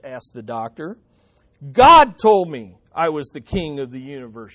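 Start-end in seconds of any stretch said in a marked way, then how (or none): 1.7-4.5 loud burst on the first word, then falling; acted shout of a deluded patient; shouting madman. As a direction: blurt it out as indisputable proof. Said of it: "shouted Harry".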